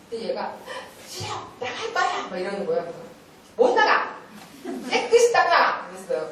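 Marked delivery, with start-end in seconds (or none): 1.1-2.3 put-on high-pitched child's refusal; defiant child. Child refusing to clean up for the teacher, defiant high voice. 3.5-5.9 high, loud, forceful order; stern command. Retold or imitated. Teacher refusing to let the child leave, stern loud command.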